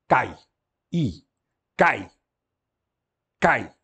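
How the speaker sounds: Cai broken into its letters, then said whole; spelling then model word. Shown as a demonstration. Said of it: "K, alfa, iota".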